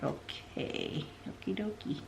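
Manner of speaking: whispered, almost voiceless okie dokie; hushed meek okie dokie. Retold or imitated. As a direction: mutter it under your breath, defeated. Retold meek acceptance.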